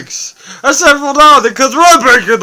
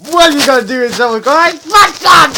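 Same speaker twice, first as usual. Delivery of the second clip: usual speaking voice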